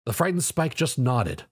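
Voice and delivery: plain reading voice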